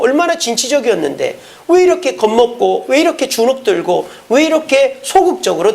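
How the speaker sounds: high-pitched, pressing rebuke; scolding. Acted. A master telling off a disciple who lost nerve, scolding. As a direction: scold with rapid-fire reproachful questions. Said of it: "야단치고는".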